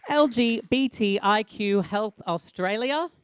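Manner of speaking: normal talking voice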